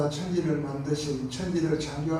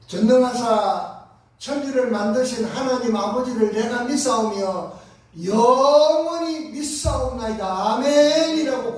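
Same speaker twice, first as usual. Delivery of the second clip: bursting confession; 6 semitones higher, 7 dB louder than usual. Retold or imitated. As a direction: let the creed burst out of you. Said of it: "고백이 터져 나오는 거예요".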